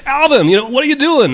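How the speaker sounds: high, loud exasperated yell; mock-scold the kid at full pitch; mock yell at Alvin. Retelling a grown-up yelling at Alvin, high and loud. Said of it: "you'd yell at him".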